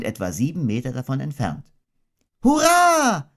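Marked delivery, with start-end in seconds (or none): 2.4-3.3 put-on high cheer of a delighted child; a child's bright, high shout of joy; excited high hurray. A narrator's reading. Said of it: "hast du gerufen".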